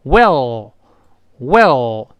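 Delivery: well, drawn out and falling; well, said slowly with the tongue tip pushed up; well, a slow teaching demo with a falling tune; slow model well. Shown as a demonstration. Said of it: "是这么一个声音啊".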